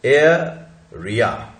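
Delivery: slow, split in two, with the र of या sounded; R pronounced. Shown as a demonstration. Teacher shows that R between vowels is spoken, pronounced slowly. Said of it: "इसलिए बोला जाएगा".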